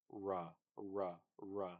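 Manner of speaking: ra ra ra with lips rounded, "ra" like rwa; labialized r. Shown as a demonstration. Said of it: "So we have something like this".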